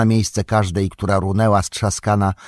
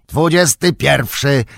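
raised, higher, slow triumphant call; 6 semitones higher, 4 dB louder than usual. A narrator's reading. Gimli counting his last orc kill, calling out. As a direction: call the tally out. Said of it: "zawołał Gimli".

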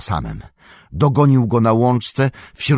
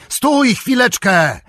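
much higher, slow drawn-out call after them; 5 dB louder, slower than usual. Acted. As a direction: call them back before they run. Aragorn calling after fleeing hobbits. (przeciągłe wołanie, dużo wyżej)

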